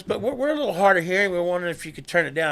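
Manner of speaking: a lightly mocked old customer asking to turn it down; hard-of-hearing patron. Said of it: "He goes".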